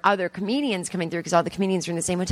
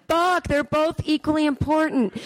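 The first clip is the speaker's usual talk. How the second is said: mock panic; 9 semitones higher than usual. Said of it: "And I'm like".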